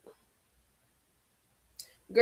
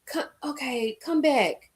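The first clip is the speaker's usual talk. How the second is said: mock sympathy; 2 semitones higher, 5 dB quieter than usual. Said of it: "Mel gonna hear that and say".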